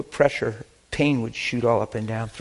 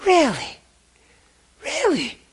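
high falling wonder; amazed really; slower than usual. Retold or imitated. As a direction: repeat it in stunned disbelief. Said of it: "She says".

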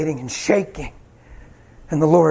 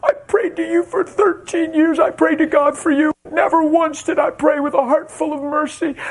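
crying, pitched high; tearful confession; 9 semitones higher than usual. Retold or imitated. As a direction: confess through tears. Wife's tearful confession retold.

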